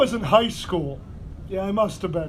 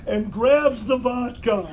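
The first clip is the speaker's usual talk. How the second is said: usual speaking voice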